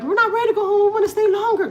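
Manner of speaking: put-on high, loud kid voice; whiny children begging to stay; imitating the kids. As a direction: kids pleading to stay another day. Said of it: "my kids were like".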